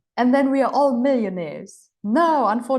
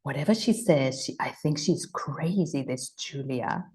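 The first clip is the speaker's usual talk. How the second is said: sceptical inner voice; 7 semitones lower, 5 dB quieter than usual. Pretend. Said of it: "you might think".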